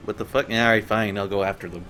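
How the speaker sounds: normal talking voice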